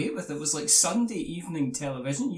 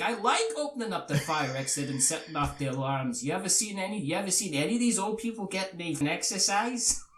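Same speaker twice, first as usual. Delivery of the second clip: mock Jersey guy; lightly voiced New Jersey accent; 4 semitones higher than usual. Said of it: "is that your Jersey accent?".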